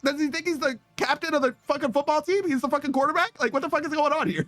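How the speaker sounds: high incredulous ranting; high-pitched rant. Real, not acted. Streamer ranting to the boys about the boyfriend, high incredulous.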